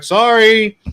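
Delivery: loud, high-pitched call; retold shouted apology; yelling back. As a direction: call it out across a parking lot. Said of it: "I'm yelling back to him".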